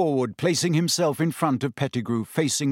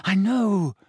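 high, breathless admission; urgent, winded agreement; panting; 7 semitones higher, 3 dB louder than usual. A narrator's reading. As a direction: get it out between pants. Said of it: "Harry panted".

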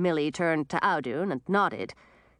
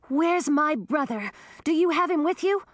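frantic; 9 semitones higher than usual. A narrator's reading. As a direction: demand it in a rush of fear. Girl fearing for brother.

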